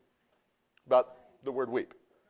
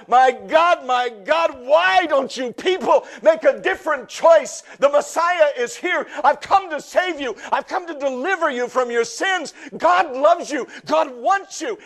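high, loud, anguished cry to the people; wailing plea; 13 semitones higher, 9 dB louder than usual. Acted. Jesus wailing over the city's people, high loud plea. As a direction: cry out to them, pleading and grieving. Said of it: "Jesus began to literally sob and wail aloud".

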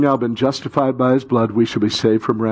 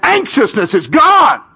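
high, loud, building preacher shout; fervent pulpit proclamation; worked-up preaching; 10 semitones higher, 5 dB louder than usual. Real, not acted. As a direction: preach it with rising fire. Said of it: "you're getting a little worked up".

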